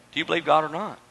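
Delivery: low, soft challenge to the listeners; hushed, serious asking; quiet, sober question. Real, not acted. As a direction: drop right down and put it gently. Preacher turns question to congregation.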